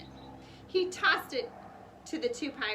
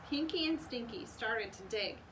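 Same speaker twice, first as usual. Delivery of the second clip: ordinary delivery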